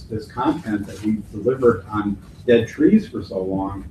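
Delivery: ordinary delivery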